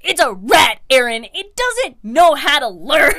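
loud, exasperated acted yelling; mock-furious shout breaking into laughter; so mad. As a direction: imitate an outraged classmate, loud and emphatic. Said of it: "they were so mad".

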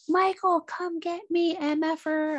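high, loud taunting yell; daring the killer at the foot of the stairs, shouting. Retold or imitated. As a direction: call him out, screaming up the stairs. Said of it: "She's like".

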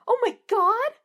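thrilled screeching Juliet voice. A narrator's reading. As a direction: shriek it in giddy excitement. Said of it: "Juliet screeched".

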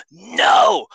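a high, loud no of surprise; shocked shout. Retold or imitated. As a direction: yell it in disbelief. Shocked twist in the story.

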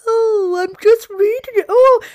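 high, loud mimicry of Jodie's excuse; mocking imitation. Teasing host mimics co-host's excuse in a high mocking voice. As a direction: parrot her excuse back, high and loud. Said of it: "it's like".

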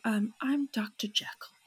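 whispered "I'm Doctor Jekyll" in a badly faked accent; mock the clumsy accent on "Doctor Jekyll"; really bad accent. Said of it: "in a really bad accent".

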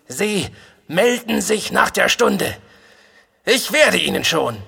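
high, tense reprimand; hoarse threat. A narrator's reading. NCO ordering the cadet to report after class, hoarsely threatening. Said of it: "sagt rasch und heiser, ohne den Gerufenen anzusehen".

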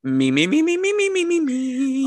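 rising me-me-me vocal warm-up. Shown as a demonstration. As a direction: warm up the voice before the impression. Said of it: "I'm going to give his response".

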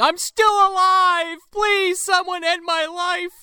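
very high, slow wailing voice; trapped ghost. Pretend. Aunt Agatha trapped in the dryer, high slow wail. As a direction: moan like a soul trapped in the dryer. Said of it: "Aunt Agatha in there screaming".

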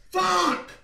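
high, loud one-word yell; sudden high holler; playful shout. Pretend. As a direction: belt it into the mic. Shouting contest into the microphone.

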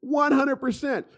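pumped-up yes, man; excited agreement. Real, not acted. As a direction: hammer home that he's right, laughing into it. Excited agreement after the quote.

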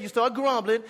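normal talking voice

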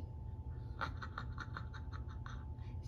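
soft, whispered wicked laugh; evil fox chuckle. Acted. Fox spies the fat birds, evil chuckle. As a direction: snicker low like a scheming villain. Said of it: "he was very evil".

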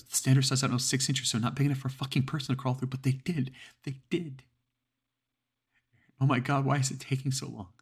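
high, loud and fast with terror; panicked rant. Acted. Caller telling the dispatcher about the vents, panicked. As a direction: spill it out in rising panic. Terrified caller on emergency call.

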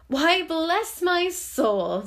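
loud, high, drawn-out amazement; acted startled outburst; astonished exclamation. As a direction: cry out slowly in delighted shock. Fred startled by his uncle's arrival.

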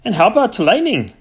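furious farmer scoffing; indignant farmer. Acted. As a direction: scoff at the vet in outrage. Cranford argues.

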